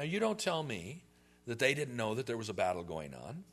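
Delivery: ordinary talk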